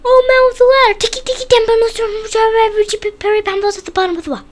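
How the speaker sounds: high shout racing to the end; last-breath shout. A narrator's reading. Chang shouting to the old man with his last breath, high and rushed. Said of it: "with his very last breath he shouted".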